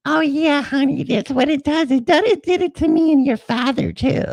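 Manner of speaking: high sweet falsetto, a bit witchy; witchy mom voice. Retold or imitated. Mom explains the virus to her son, high witchy voice. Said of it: "sounds like a witch".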